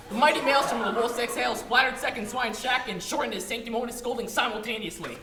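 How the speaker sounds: ordinary talk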